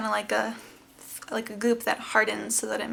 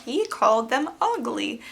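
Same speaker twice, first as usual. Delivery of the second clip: slow, drawn-out tearful complaint; imitated crying; 4 dB louder than usual. Crying patient repeats it to the nurse, drawn-out mock wail. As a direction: whimper it slowly, playing up the hurt. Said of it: "And I'm just crying".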